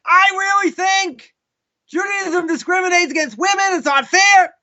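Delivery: upset insistence. Acted. Person coming back to complain about discrimination, upset and insistent. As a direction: push the complaint harder, mock indignant. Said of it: "this guy gets upset".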